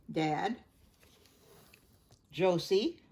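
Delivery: ordinary delivery, nothing marked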